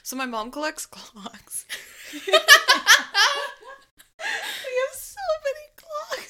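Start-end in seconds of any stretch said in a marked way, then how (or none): 1.7-6.3 high squeaky laugh through words. Real, not acted. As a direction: crack up while saying it. Laughing about mom's clocks.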